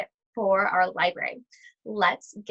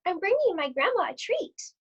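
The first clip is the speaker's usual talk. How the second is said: little Red's voice; 7 semitones higher than usual. Acted. Red speaks to the wolf.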